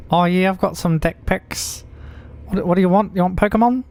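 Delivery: high, exaggerated Kiwi bloke voice; hammed-up New Zealand accent. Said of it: "attempt at a New Zealand accent".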